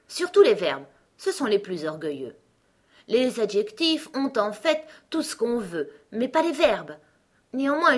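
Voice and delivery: boastful Gros Coco. Acted. Gros Coco boasts of taming words, self-important. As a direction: brag like a master of words.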